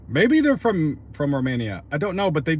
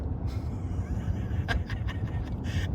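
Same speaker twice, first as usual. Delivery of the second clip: amused, softer laugh; real laugh; 7 dB quieter than usual. Real, not acted. Vlogger recalls being heckled by a passerby, laughing. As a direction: laugh it off without words.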